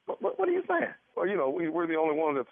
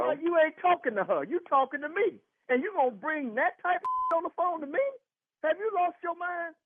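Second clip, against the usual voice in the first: high, heated scolding; angry husband; 10 semitones higher than usual. Real, not acted. Husband confronting caller about his wife, heated and high. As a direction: chew out the fake doctor.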